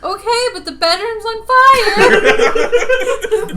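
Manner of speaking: put-on slow kid's protest, breaking into laughter; little kid. Little kid tells mom about a fire, loud child voice. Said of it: "it's like little kids when they're like".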